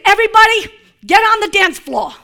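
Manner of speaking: loud call to the dancers; party call. Retold or imitated. Imagined host calling guests to dance, loud. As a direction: shout everyone onto the floor. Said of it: "we would say".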